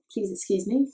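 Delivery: ordinary talk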